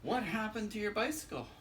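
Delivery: a light lift for the curious parent; questioning parent. Acted. Parent asking the little critter about his bike, lightly voiced. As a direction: ask it like a puzzled grown-up.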